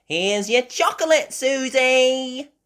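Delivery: high, loud cartoon piglet; high-pitched little pig voice; Peppa. A narrator's reading. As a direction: call it out in a high character voice. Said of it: "Peppa said".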